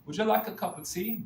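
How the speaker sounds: acted British English accent. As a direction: put on a British accent for a polite offer. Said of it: "If they have a British accent".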